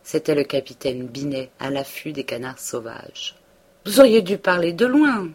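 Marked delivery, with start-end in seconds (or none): 3.9-5.3 loud, higher startled cry; exclaiming. A narrator's reading. Binet crying out to Emma from his barrel, loud and startled. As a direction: call out sharply, caught off guard. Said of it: "s'écria-t-il".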